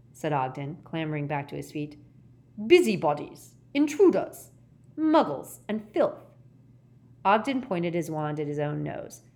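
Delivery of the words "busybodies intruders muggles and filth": high, loud list of insults; contemptuous. Acted. Gaunt answering Ogden, contemptuous. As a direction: hurl each word separately with scorn.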